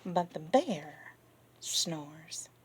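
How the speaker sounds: lively read-aloud, rising and falling; children's story reading. Acted. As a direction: read to the kids with wonder. Reading picture book to kids.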